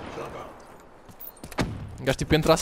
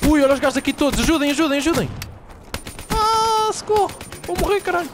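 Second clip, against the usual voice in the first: panicking gamer; 12 semitones higher than usual. Real, not acted. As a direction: yelp for help as enemies swarm. Swarmed by enemies, calling help.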